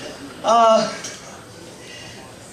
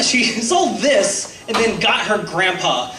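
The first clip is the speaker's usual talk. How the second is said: ordinary talk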